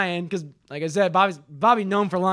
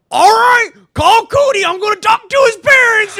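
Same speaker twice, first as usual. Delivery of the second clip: high, very loud fatherly command; mock shouting dad; 12 semitones higher, 10 dB louder than usual. Son imitates dad ordering a call to Cody, furious shout. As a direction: bellow it like a fed-up father. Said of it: "first thing Dad says".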